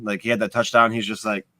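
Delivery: ordinary talk, nothing marked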